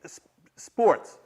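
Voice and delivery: acted-out awkward blurt; forced admission. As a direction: stall on the "s", then push the word out. Forced to repeat confession.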